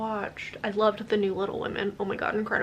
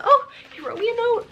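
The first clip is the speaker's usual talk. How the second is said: touched delight; 13 semitones higher, 4 dB louder than usual. Real, not acted. Finding friend's note in book.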